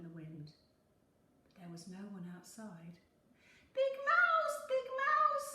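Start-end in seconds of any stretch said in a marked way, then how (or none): 3.7-4.6 high little mouse voice, calling out loud; a tiny mouse calling for his big brother; high squeaky call, much louder. A narrator's reading. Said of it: "called Little Mouse". 4.7-5.5 high little mouse voice, calling out loud; a tiny mouse calling for his big brother; high squeaky call, much louder. A narrator's reading. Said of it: "called Little Mouse".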